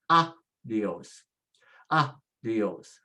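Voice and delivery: adios with A stressed, a break before dios; stress next to last. Shown as a demonstration. Teacher shows what the rule would give, stressing adios on the first syllable. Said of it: "next to the last".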